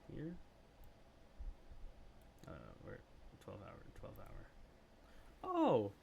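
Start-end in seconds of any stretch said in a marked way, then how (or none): 5.4-5.9 high, loud "really?"; delighted surprise. Real, not acted. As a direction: squeal with real surprise. Surprised reaction to chat news.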